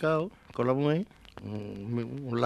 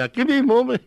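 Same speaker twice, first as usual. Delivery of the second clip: scared blurt; 13 semitones higher, 8 dB louder than usual. Retold or imitated. Los dos responden asustados.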